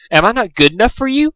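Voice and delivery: high plaintive whine; whining. Acted. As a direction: whine it like a spurned lady. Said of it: "she whined".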